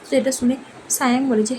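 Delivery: usual speaking voice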